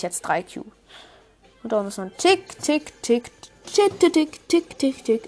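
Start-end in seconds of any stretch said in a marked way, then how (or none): 2.2-5.3 high sing-song tick tick; ticking countdown. Pretend. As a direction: count down like a clock. Counting down the timer.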